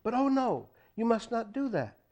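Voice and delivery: sudden. A narrator's reading. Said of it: "Shula said suddenly".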